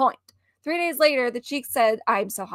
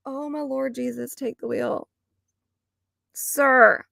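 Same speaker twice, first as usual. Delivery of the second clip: usual speaking voice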